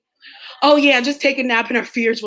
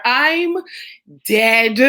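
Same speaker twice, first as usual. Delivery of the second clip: normal talking voice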